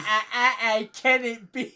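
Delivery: mock awe. Mock awe at bad film.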